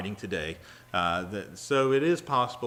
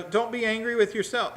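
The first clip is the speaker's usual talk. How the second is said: higher, earnest comfort; reassuring Joseph; 12 semitones higher, 5 dB louder than usual. Acted. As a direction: urge them kindly. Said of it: "but he's also saying".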